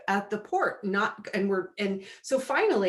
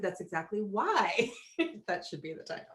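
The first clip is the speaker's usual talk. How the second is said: laughing line; 6 dB quieter than usual. Real, not acted. Laughing punchline.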